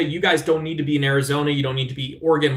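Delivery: normal talking voice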